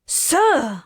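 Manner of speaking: high, loud call to stop; calling him to order; sharp exclamation. Acted. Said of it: "exclaimed the flight attendant".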